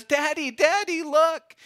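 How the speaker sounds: a small daughter calling her daddy to look; little girl calling. Retold or imitated. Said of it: "sweet little girl".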